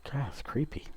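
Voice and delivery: spooked whisper. Real, not acted. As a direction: hush it, creeped out. Creeped out, whispering.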